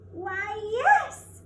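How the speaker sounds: high, loud surprised answer; great surprise. A narrator's reading. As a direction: burst out in delighted surprise. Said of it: "with great surprise".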